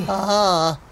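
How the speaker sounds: drawn out, wilting from heat; gequält langsam. Acted. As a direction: stretch out Ich verbrenne, suffering. Overheated cat, melodramatic. (gequält und gedehnt)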